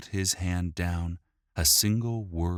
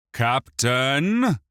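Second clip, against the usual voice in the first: commanding; 8 semitones higher, 7 dB louder than usual. A narrator's reading. Regent about to order.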